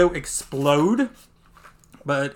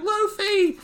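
high-pitched mock cry; astonished cry. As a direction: squeal the name in shock. Acting onlookers' astonished cry.

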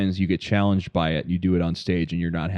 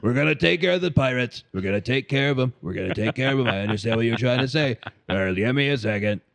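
groggy Trump voice; 4 semitones higher than usual. Retold or imitated. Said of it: "groggy voice now".

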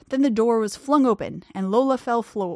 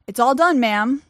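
louder, called down the stairs; calling down; 4 semitones higher, 8 dB louder than usual. A narrator's reading. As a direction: call out reassuringly to her below. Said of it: "George called down".

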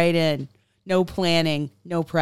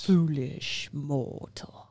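Haunted Mansion ghost host; 2 semitones lower, 3 dB quieter, much slower than usual. Acted. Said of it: "This month's five K celebrates the Haunted Mansion".

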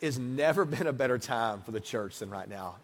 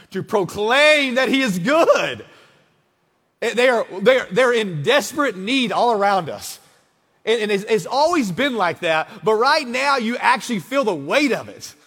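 higher and much louder, driving the point home; full-force preaching; 6 semitones higher, 8 dB louder than usual. Acted. Pastor urging his church in despair, full preaching. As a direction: proclaim it over the crowd.

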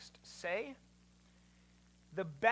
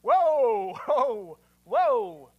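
loud, drawn-out whoas; big stop-right-there outburst, stepping down in pitch; alarmed halting; 12 dB louder, much slower than usual. Acted. Acts out halting a startling idea.

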